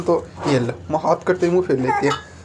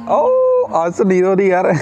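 laughing coo; 5 semitones higher, 5 dB louder, slower than usual. Real, not acted. Cooing at crying baby girl.

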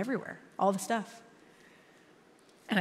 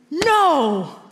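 loud, high, drawn-out protest; mad outcry; 8 semitones higher, 13 dB louder than usual. Retold or imitated. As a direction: cry out in anger, re-enacting the moment. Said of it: "And I got mad".

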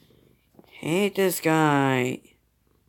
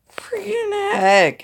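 frustrated squeal; 4 dB louder, slower than usual. Real, not acted. Frustrated at losing a game battle.

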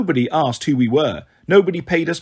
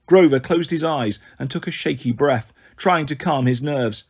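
ordinary delivery, nothing marked

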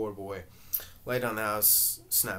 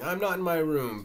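loud, higher shout; 6 semitones higher, 6 dB louder than usual. A narrator's reading. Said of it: "Willie shouting".

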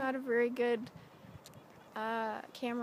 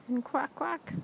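duck quack; 4 semitones higher, 4 dB louder than usual. Shown as a demonstration.

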